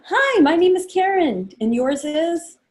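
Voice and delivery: cheery high hostess hello; perky party-host welcome; bright greeting. Shown as a demonstration. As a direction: greet a new guest brightly. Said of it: "you wanna greet them".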